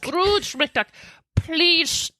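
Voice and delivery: cartoon Scottish voice pleading; light Scottish cartoon-duck voice; Scrooge McDuck Scottish accent. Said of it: "Do a Scottish accent".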